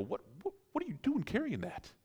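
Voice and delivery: high, soft, stammered disbelief; stunned disbelief. Acted. Onlookers question someone carrying a family diamond, stunned. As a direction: balk at what you see, voice jumping up.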